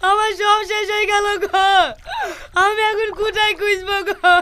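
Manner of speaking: hammed-up wailing lament; crying. Man lamenting that everything is gone, crying. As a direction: wail as if all is lost. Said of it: "তুই এভাবে কাঁদছিস কেনে".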